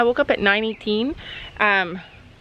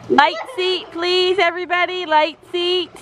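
higher, called out like an arena judge; brisk projected riding commands; 5 semitones higher than usual. Pretend. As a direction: announce it to the riders. Mom calls riding commands.

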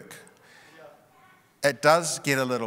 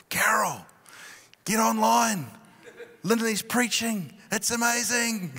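higher and thrilled, urging; excited phone call; 10 semitones higher than usual. Retold or imitated. Pastor calling his wife during the sermon, excitedly higher. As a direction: gush about it, can't contain the delight.

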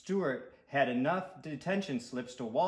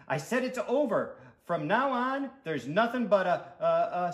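put-on higher, loud boy's declaration; insistent, then faltering; 6 semitones higher, 6 dB louder than usual. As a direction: start adamant, then stumble over the words.

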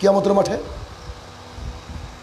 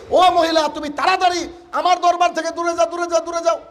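high urgent plea to go away; alarmed dismissal; 7 semitones higher than usual. Acted. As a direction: shoo her off in alarm. Prophet sends woman away.

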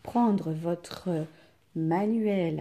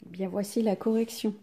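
usual speaking voice

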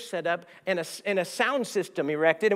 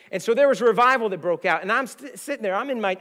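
louder, rising with excitement; excited preaching; 4 semitones higher, 7 dB louder than usual. Real, not acted. Preacher telling his church the revival broke out, excited. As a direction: announce the revival with joy.

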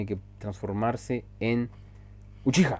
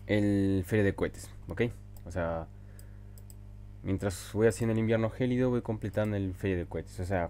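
normal talking voice

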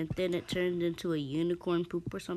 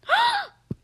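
loud, high gasp; shocked gasp; 10 dB louder than usual. Real, not acted. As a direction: gasp sharply in surprise. Surprised by something in the game.

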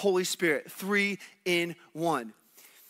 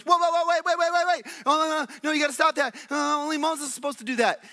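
alarmed shout; 7 semitones higher than usual. Retold or imitated. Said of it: "and he goes".

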